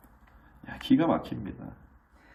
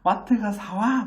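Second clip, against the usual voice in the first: put-on high, loud, slow child's demand; bossy kid threatening; 11 semitones higher, 5 dB louder, slower than usual. The child ordering dad to the mart, high and slow. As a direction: lay down the law like a cheeky kid. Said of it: "막 으름장 놓는 거예요".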